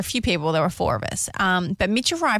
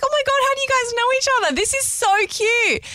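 high-pitched, retold squeal of delight; gushing excitement; 14 semitones higher than usual. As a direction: gush as if thrilled for two friends. Retelling her delighted reaction.

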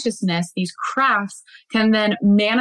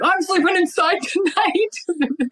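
laughing imitation; 10 semitones higher, slower than usual. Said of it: "they were like".

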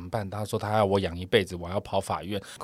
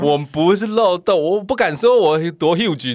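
defensive protest; 8 semitones higher, 6 dB louder, slower than usual. Real, not acted. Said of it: "defensive protest".

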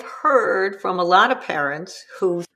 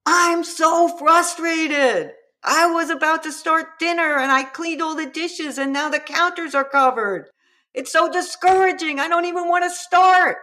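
frustrated parent; 8 semitones higher than usual. Shown as a demonstration. Parent showing kids how to voice frustration, exasperated. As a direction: vent your own feelings, not blame. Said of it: "you can yell".

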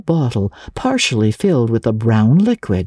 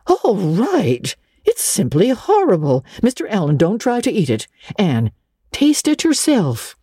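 horrified Marilla; 7 semitones higher than usual. A narrator's reading.

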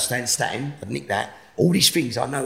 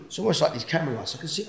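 ordinary talk, nothing marked

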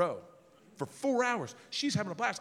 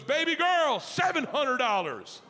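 louder, higher, slow showy bid; 8 semitones higher, 5 dB louder, slower than usual. Retold or imitated. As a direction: call it out grandly across the room. Old boy bidding loudly.

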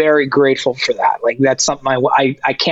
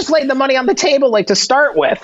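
exasperated; 8 semitones higher than usual. Real, not acted. Exasperated replay.

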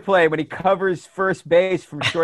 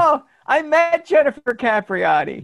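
excited; 10 semitones higher, 3 dB louder than usual. Real, not acted. Recognizes the name, bursts in.

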